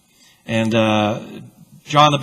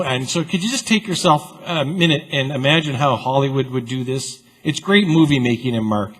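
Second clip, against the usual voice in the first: ordinary delivery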